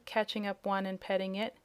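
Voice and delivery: plain reading voice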